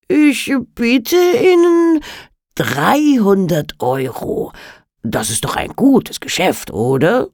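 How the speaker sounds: slow, smooth-talking dealer; a sly businessman making an offer; Alligator voice. Acted. Alligator character voice. (listiger Geschäftsmann)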